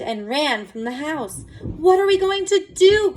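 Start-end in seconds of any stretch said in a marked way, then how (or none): 1.6-3.1 high, loud despairing cry; desperate cry. A narrator's reading. Mother crying out over her changing daughter, desperate. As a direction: cry it out in panic. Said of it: "cried Missus Cream".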